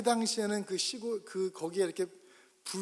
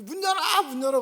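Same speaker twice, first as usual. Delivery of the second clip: loud, much higher shout; 9 semitones higher, 9 dB louder than usual. Retold or imitated. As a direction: bang on the door and demand it opens. Mother pounding on door.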